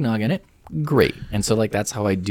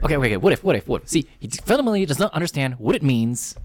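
raised-pitch debater impression, words tumbling out; Ben Shapiro; 6 semitones higher than usual. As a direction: imitate a pundit picking apart an argument, pitched up. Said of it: "You do a really good Shapiro impression".

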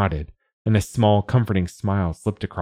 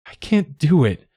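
high, strained outburst through tears; tearful croak; 9 semitones higher, 4 dB louder than usual. A narrator's reading. Child admitting defeat to dad after crying, tearful croak. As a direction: croak it out, pitched up in distress. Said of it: "I croaked at last".

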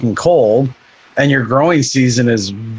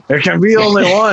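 a bad Scottish accent, pitched a bit higher, Highlander style; hammed-up Scottish movie line; terrible Scottish accent; 6 semitones higher than usual. Joking about diets on a podcast, bad Scottish accent. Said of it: "that was my terrible Scottish accent".

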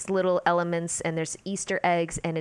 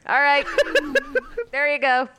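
high, loud, laughing send-off; mock send-off; 8 semitones higher, 6 dB louder than usual. Acting out Obi-Wan throwing Luke off a speeder, mock send-off. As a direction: playfully call it out through laughter.

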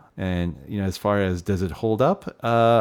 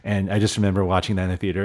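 ordinary talk

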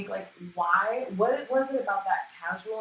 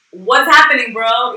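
loud, higher panicked mock yell; mock freak-out; 6 semitones higher, 20 dB louder, slower than usual. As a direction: act out the frantic overreaction, hammed up. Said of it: "I'm freaking the fuck out".

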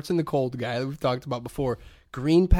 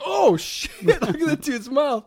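laughing high-pitched blurt, laughing through it; giggling shocked exclamation; 12 semitones higher, 4 dB louder, slower than usual. Retold or imitated. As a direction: crack up while blurting it out. Retold shocked outburst with laughter.

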